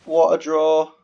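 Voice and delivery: high, loud sarcastic remark; mock the bad luck; sarcastic exclamation. Real, not acted. Streamer sarcastic about his draw, loud and high.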